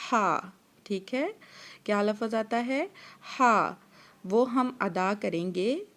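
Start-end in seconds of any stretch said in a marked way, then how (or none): 3.4-3.7 ہا from the middle of the throat, breathy and firm; Arabic haa. Shown as a demonstration. Teacher demonstrates throat ہا. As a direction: push ہا out from mid-throat. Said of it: "وسطی حلق سے".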